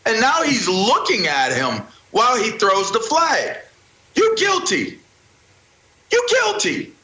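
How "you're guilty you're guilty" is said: high-pitched emphatic accusation; accusing verdict. Acted. Commentator passing verdict on the referee, high and accusing. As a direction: pronounce guilt, pitched way up.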